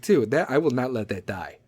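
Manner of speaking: usual speaking voice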